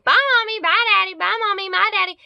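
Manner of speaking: high, loud taunting sing-song; sister imitating teacher's mockery. Sister retells the teacher's taunt to dad, high sing-song. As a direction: squeal the goodbyes the way the teacher mocked. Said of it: "Missus Teacher was saying".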